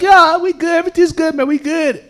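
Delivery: high-pitched mock reassurance; hasty reassurance. Walker reassuring the truck driver, high placating mock voice.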